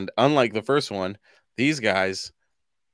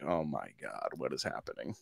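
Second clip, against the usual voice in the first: much softer, much lower stunned mutter; mock bewildered hush; 5 semitones lower, 9 dB quieter than usual. Viewer stunned by a Bigfoot video, hushed and bewildered. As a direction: mumble it like you can't believe your eyes. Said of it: "and you're like".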